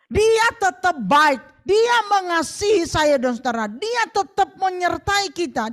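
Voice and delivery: forceful sermon; full preaching. Acted. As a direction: preach it with conviction.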